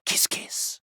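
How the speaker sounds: breathy whispered kiss kiss; whispered kisses. Acted. Mascot whispering kisses to his favorite player.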